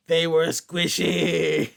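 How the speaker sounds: put-on slow, eerie kid line; creepy kid. Podcaster reading a creepy kid's line to cohost, slow and eerie. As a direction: drag it out like an unsettling child.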